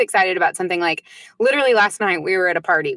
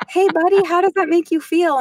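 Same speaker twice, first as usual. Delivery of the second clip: sweet mom voice; 9 semitones higher than usual. Retold or imitated. Mom gently asks her son about his feelings, in a high sing-song voice. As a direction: coo at the child like a gentle therapist. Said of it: "I was like".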